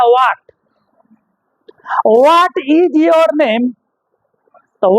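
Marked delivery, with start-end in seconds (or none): 0.0-3.7 Bihari English. Retold or imitated. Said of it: "Bihar वाला बोलता".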